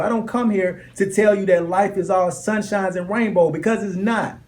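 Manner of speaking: loud, high, driving preacher voice; preaching at full force. Acted. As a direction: hammer each line home like a fiery sermon. Preaching to a class.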